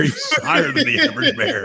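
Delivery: high laughing quip; laughing punchline. Real, not acted. Joking about Cocaine Bear, laughing high. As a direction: toss the pun up high through laughter.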